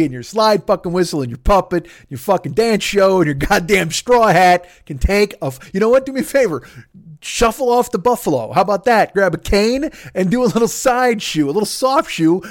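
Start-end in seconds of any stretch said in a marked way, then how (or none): none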